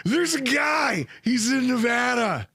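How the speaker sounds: high, slow mock yell; retold yelled excuse. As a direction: re-enact a hollered explanation to a spouse. Own flustered yelled reply retold.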